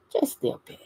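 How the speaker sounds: soft unvoiced murmur; whispered aside. Real, not acted. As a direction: breathe it out as an aside. Whispered aside repeating herself.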